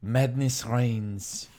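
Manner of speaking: put-on German accent, Herzog-style; a light Herzog German lilt; Werner Herzog impression. Doing his Werner Herzog for the podcast, German accent. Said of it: "Werner Herzog".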